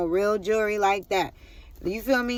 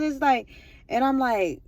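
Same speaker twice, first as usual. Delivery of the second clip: ordinary talk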